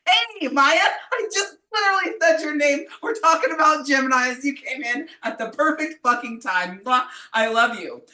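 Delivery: high, loud delighted hello; excited greeting. Real, not acted. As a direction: greet a friend bursting in. Greeting friend who walked in.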